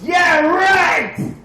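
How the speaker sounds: loud, scoffing wife; mocking wife shouting her disbelief; sarcastic shout. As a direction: yell it in disbelief. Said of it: "She goes".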